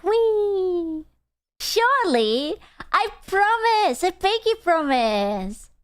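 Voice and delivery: high, slow, sing-song pleading; a hammed-up pinky promise; eager promising. Own eager reply quoted.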